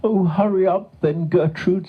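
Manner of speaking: high, anxious storybook cow voice; pleading cow. A narrator's reading. Stuck cow begging her friend to hurry, anxious.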